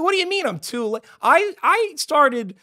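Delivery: high-pitched disbelieving protest; retold indignant yelp; indignant outburst. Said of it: "I'm just yelling to the ether".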